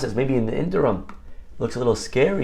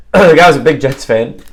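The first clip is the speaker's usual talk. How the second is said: the line again after clearing the throat, much louder; restarted louder; 3 semitones higher, 10 dB louder than usual. Shown as a demonstration.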